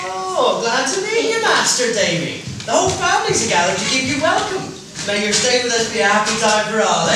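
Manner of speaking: high and loud, beaming; hearty welcome. Acted. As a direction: greet the boy with delight.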